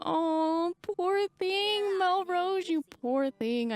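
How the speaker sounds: drawn-out cooing sympathy, high then sinking; slow, doting commiseration; playful pity. Pretend. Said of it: "I feel so bad for her".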